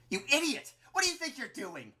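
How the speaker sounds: high, loud outraged yell; angry shout. A narrator's reading. Flowey facing Clover's drawn gun, angry shout. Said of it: "Flowey shouts".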